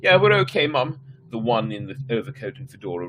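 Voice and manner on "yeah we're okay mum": put-on high, louder kid voice; young boy. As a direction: answer like a shaken little boy. Boy answers his mother.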